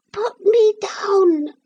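very high, slow little squeak; teeny tiny voice. A narrator's reading. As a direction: speak as a tiny wooden figure. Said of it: "teeny, tiny voice said".

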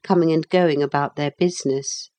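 plain reading voice

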